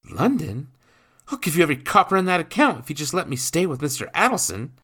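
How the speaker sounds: put-on high, loud, fast boy's plea; boy pleading. Boy to his stepfather, high fast pleading. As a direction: beg with everything he has. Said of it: "Jacques pleaded".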